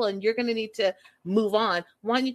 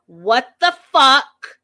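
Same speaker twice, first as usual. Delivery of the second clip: loud, drawn-out yell, pitched up; yelled outburst; 4 semitones higher, 12 dB louder, much slower than usual. Retold or imitated. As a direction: re-enact a shocked yell at full volume.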